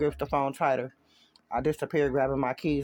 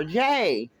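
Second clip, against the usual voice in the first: louder call out for Jay; 3 semitones higher, 5 dB louder than usual. Acted. Girlfriend calls out for her man in the next room, louder. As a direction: call him across the house. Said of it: "Her voice gets louder".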